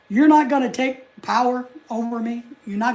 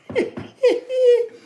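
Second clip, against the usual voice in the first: high-pitched laugh in short bursts; high giggle; 14 semitones higher, 3 dB louder than usual. Real, not acted. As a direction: break into a squeaky giggle. Laughs at own unfinished joke.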